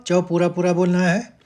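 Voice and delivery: usual speaking voice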